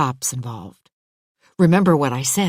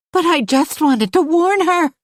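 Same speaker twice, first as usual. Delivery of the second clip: Diane, upset; 11 semitones higher, 6 dB louder than usual. Acted. Diane defends herself, upset.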